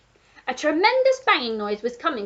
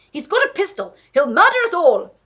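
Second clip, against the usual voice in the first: higher, loud alarmed Duke; Duke warning; 8 semitones higher, 10 dB louder than usual. A narrator's reading. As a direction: warn her urgently. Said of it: "shouted the Duke".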